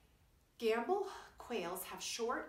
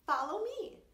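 calling out; 12 semitones higher, 9 dB louder than usual. A narrator's reading. Quincy calls family.

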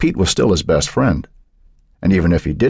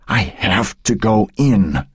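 slow, higher, strained insistence; insistent old woman; 4 semitones higher, slower than usual. A narrator's reading. Old woman desperate to get inside her house, insistent. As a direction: drag the words out, insisting. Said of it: "the old woman insisted".

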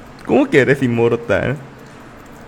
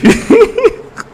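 a much higher squealing laugh; high giggle; 5 dB louder than usual. Real, not acted. Streamer laughing at his own joke, high squealing giggle.

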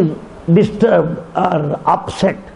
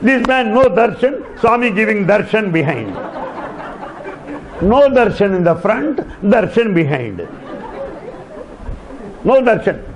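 high, amused, chuckling through it; amused retelling with laughs; laughing while telling; 6 semitones higher than usual. Real, not acted. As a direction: tell it through real laughter. Laughing at funny darshan story.

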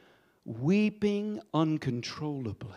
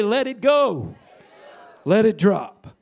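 louder, call it out for all to repeat; loud preaching call; 4 semitones higher, 9 dB louder than usual. Real, not acted. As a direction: proclaim it to the congregation. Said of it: "Everybody say".

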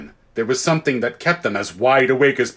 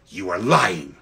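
cold accusation; 6 semitones lower, slower than usual. Acted. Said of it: "said the Queen".

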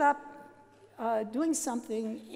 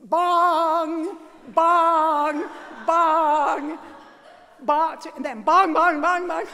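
loud, high, sung bong bong; sung bell tolls; 4 semitones higher, 13 dB louder, much slower than usual. Retold or imitated. Imitating the Angelus bells for the audience, loud sung tolls. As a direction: ring each bong out like a bell.

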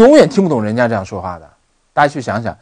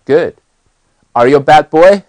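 usual speaking voice

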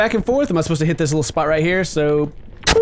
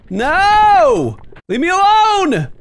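high, loud mock-terrified cry; hammed-up victim in distress; panicked plea; 12 semitones higher, 4 dB louder, much slower than usual. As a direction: wail to be left alone. Pleads as the attacked worm.

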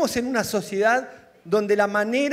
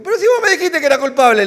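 loud, fast, indignant cry; mock outraged shout; 6 semitones higher, 10 dB louder, faster than usual. Lawyer on TV shouting at his client. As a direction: blurt it out like the shocked lawyer. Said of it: "un abogado que hay por la televisión que le dijo".

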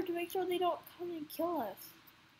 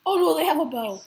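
sudden loud panicked yell; alarmed shout; 11 dB louder than usual. Real, not acted. Sees enemy archer, panics.

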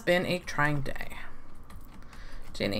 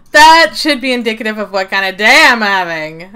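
loud; 11 dB louder than usual. Real, not acted. Loud after laughing fit.

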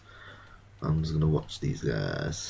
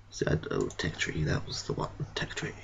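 softer whisper while browsing; whispered muttering; 3 semitones lower, 8 dB quieter than usual. Real, not acted. Gamer whispering to himself in the tech tree. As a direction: mutter under your breath.

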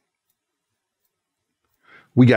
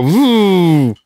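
engine noise imitation; 4 semitones higher, 4 dB louder than usual.

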